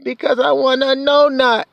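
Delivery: put-on very high little-boy whine; crying toddler. Two-year-old son explaining his tears to dad, high mock-crying voice. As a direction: imitate a tearful two-year-old pleading. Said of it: "So I saw him crying".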